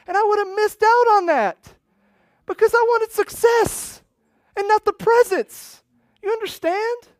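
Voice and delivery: very high, loud, overwhelmed preaching; impassioned outcry. Real, not acted. Preacher overcome at the blessing he nearly missed, impassioned outcry. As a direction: cry it out with everything you have. Relives breaking down, preaches it passionately.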